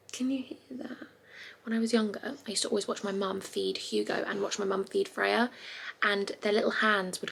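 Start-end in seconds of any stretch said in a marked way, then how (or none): 0.1-1.1 hushed whisper, barely voiced. Real, not acted. Mum asking viewers to listen, whispering. Whispering beside the sleeping baby.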